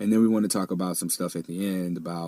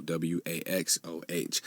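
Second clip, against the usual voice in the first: spelled out; 7 dB quieter, slower than usual. Shown as a demonstration.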